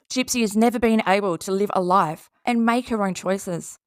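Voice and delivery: ordinary talk, nothing marked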